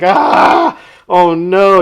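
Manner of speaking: high, loud startled "Ah, oh no"; a jumpy, caught-out yelp. Retold or imitated. Caught by his boss.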